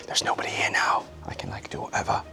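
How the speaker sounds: whispered, breathy aside; whispering. Real, not acted. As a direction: whisper it to yourself. Alone backstage, hushed.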